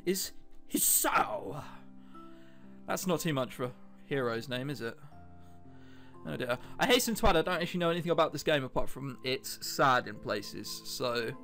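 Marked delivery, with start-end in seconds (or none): none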